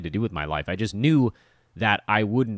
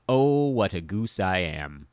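slow punchline; 3 semitones lower, slower than usual. Shown as a demonstration. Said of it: "you get the phrase".

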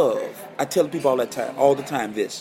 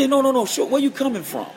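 eager question; 9 semitones higher than usual. Retold or imitated. Retold eager mentor quote.

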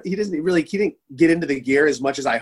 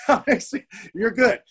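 much higher, laughing through the words; 8 semitones higher than usual. Real, not acted. Retelling his reply to Grant, breaking into laughter. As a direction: crack up mid-line.

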